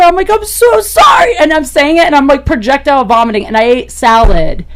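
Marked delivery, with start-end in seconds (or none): 0.3-1.4 high, loud frantic sorry; shouted apology. Retold or imitated. Drunk woman shouting sorry while throwing up, frantic. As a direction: cry out the apology in panic.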